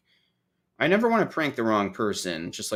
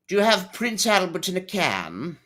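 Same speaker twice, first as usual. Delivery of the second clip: higher, put-on prank-call voice; prank caller; 10 semitones higher, 5 dB louder than usual. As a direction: pose the joke question as a phone prankster. Playacts a classic prank call.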